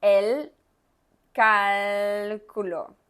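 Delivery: cálculo slow with the L tongue up at the roof; teacher demo of cálculo; clear l. Shown as a demonstration. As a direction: say cálculo slowly, placing both l's. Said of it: "Mire la L arriba de la boca".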